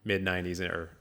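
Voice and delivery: ordinary talk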